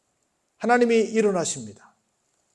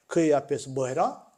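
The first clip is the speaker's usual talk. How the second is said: ordinary talk, nothing marked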